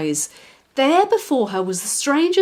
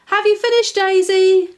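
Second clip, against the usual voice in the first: high, loud call across the garden; father calling his daughter; calling daddy; 11 semitones higher, 7 dB louder than usual. Acted. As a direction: call out to her from afar.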